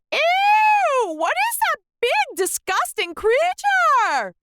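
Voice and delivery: high, loud disgusted shriek, slowing at the end; teacher shrieking in disgust; disgusted shout. A narrator's reading. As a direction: recoil and shout at the creature. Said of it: "Miss Fidget shouted".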